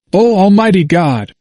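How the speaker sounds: understated narrated outcry; exclamation. A narrator's reading. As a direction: exclaim it with lifted pitch. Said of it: "exclaimed he".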